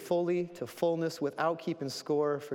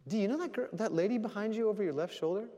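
higher-pitched retold question, a little louder; lightly acted question; 8 semitones higher, 3 dB louder, faster than usual. Retelling a man asking about the lady behind, lightly acted. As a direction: pitch it up, voicing someone else asking.

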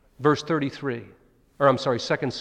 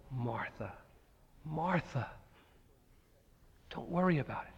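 much softer, tender; gentle reassurance; 10 dB quieter than usual. Retold or imitated. Jesus calming anxious Martha, soft and gentle. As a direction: soothe her quietly.

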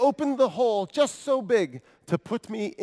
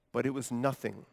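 ordinary talk, nothing marked

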